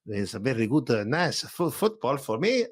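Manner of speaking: light put-on Spanish accent on football talk; a hint of a Spanish accent; mock Spanish accent. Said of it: "Shall, shall we go a bit more Spanish?".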